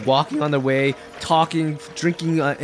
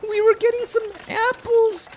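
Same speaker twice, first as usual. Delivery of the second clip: put-on very high, squeaky child voice; small lost girl answering through mock tears; tearful little girl. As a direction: play a weepy five-year-old.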